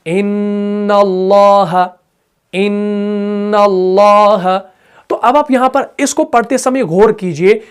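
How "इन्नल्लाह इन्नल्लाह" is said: इन्नल्लाह slow, the nn held long and humming through the nose; drawn-out ghunna. Shown as a demonstration. Teacher demonstrating nasal ghunna for students. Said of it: "जैसे हम पढ़ते हैं ना".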